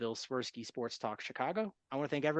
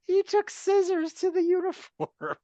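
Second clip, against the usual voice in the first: laughing; 6 dB louder, slower than usual. Retold or imitated. Laughing mock of Sale.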